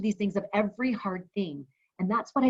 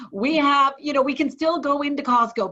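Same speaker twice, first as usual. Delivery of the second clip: louder, higher emphasis; emphatic raised reassurance; 5 semitones higher, 7 dB louder than usual. Real, not acted. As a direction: press the point, raised voice. Emphatic list of comforts.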